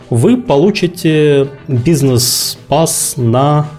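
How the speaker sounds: ordinary delivery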